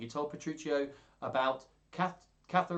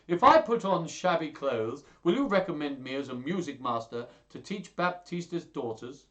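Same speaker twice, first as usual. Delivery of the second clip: high, loud acted plea; hammed-up entreaty; pleading; 4 semitones higher, 7 dB louder than usual. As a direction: beg a friend eagerly. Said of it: "pleaded Hortensio".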